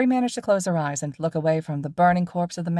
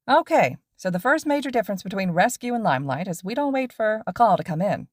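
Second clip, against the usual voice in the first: lively pro hero explaining to students; cheerful teacher lecturing his two students on patrol; upbeat hero teacher; 4 semitones higher than usual. Acted. Voices upbeat teacher Mike Sensei.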